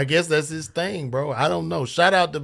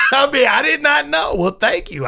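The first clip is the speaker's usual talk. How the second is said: normal talking voice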